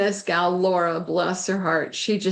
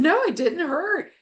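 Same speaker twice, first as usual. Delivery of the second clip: reassuring; 10 semitones higher than usual. Retold or imitated. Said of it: "She said".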